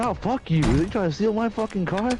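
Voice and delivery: high, outraged mock voice; indignant car owner. Car owner yelling at the thief, mock high outrage. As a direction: spit it out at the thief.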